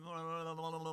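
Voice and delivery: droning, run-together blah-blah; imitated background noise. Mimicking what non-listeners hear, droning background noise. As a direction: babble on one level pitch like meaningless noise. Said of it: "like, background noise".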